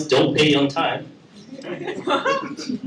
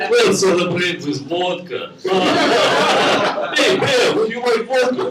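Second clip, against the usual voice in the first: hammed-up fake Russian accent, higher, ending in a shout; 7 semitones higher than usual. Russian hacker bragging and offering vodka, in a hammed-up Russian accent. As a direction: lay the fake Russian accent on thick, then shout the last line. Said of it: "has to fake Russian accent".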